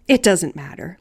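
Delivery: plain reading voice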